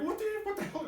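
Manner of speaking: sudden high startled cry, pitch jumping way up; shocked disbelief. Retold or imitated. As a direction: blurt it out like you just saw something impossible. Shocked at border sign.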